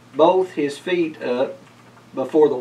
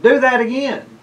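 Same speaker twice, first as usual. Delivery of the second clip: gleeful high cheer, louder; 11 semitones higher, 6 dB louder than usual. A narrator's reading. Cat cheering after Festus crashes the sled, gleeful. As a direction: cheer him on like a delighted kid. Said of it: "The cat laughed and cheered".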